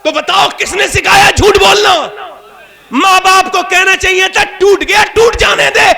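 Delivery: high, loud, slow sermon shout; fiery preacher's call; full-force preaching. Acted. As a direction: hammer the point home to the crowd.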